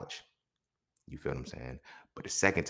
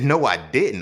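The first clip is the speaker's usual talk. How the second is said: louder, insistent no; firm denial; 7 semitones higher, 10 dB louder than usual. Retold or imitated. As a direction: cut it off flat out. Denying to police.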